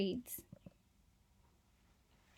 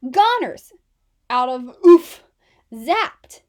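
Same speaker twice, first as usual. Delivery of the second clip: hammed-up louder wails; dramatic collapse; 13 dB louder than usual. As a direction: wail out the collapse.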